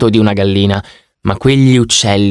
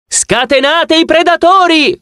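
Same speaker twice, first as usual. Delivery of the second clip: much higher, slow, hammed-up hunt cry; shouting; slower than usual. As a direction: yell it out to the crowd, slowly. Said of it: "urlò Sparky". (grido teatrale, molto più acuto e lento)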